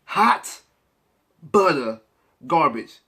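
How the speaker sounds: Hot, butter, garbage each hit apart with pauses; word by word. Acted. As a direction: chop it into three punched words. Word-by-word put-down.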